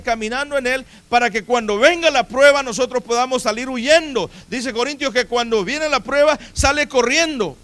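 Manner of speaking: forceful pulpit exhortation; impassioned preaching. Real, not acted. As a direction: preach it high and loud, driving the point home.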